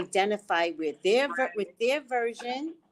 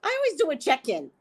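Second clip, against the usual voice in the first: laughing; 6 semitones higher, 3 dB louder, faster than usual. Real, not acted. Laughing at her own habit.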